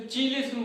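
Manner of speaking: चीलेशन with a "ch" at the start; common mispronunciation. Shown as a demonstration. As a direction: say चीलेशन as "chee-lay-shun". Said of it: "मैं भी बोलता था पहले से".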